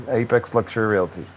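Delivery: normal talking voice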